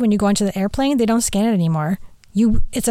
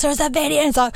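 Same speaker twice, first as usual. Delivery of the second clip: mock excited husband, pitched high; imitating husband; 5 semitones higher, faster than usual. Husband on the phone asking her, high mock voice. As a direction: play Chad breathless on the phone.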